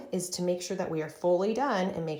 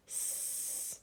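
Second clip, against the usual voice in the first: whispered spelling; 16 dB quieter than usual. Shown as a demonstration. Teacher whispering letters while writing. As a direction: softly whisper out each letter. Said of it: "Let's write this together".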